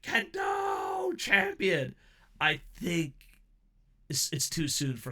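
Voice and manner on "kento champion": high screaming fanboy cheer; fanboy shout. Retold or imitated. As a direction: yell it like a starstruck fan. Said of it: "my fanboy in me is like".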